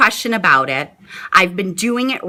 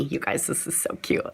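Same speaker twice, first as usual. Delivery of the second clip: whisper; 7 semitones lower, 13 dB quieter than usual. Real, not acted. Mom whispering to viewers so her daughter can't hear. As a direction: whisper it so she won't overhear. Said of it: "I'm trying to talk quiet so she can't hear me".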